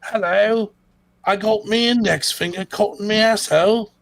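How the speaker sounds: high put-on British bloke voice; mock British accent. Mock British accent to a podcast co-host. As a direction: ham up a cockney lad.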